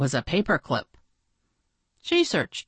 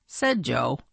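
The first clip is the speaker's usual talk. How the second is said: usual speaking voice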